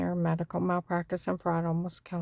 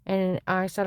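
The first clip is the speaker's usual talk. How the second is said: normal talking voice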